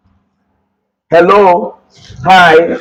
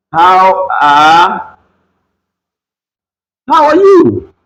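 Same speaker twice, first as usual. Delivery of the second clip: mock singsong question; much slower than usual. Playful demo in English.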